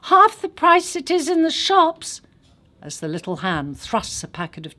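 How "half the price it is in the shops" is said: put-on high, loud child's sales pitch; a kid hawking gum; child street seller. A narrator's reading. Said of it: "the sound of a child's voice".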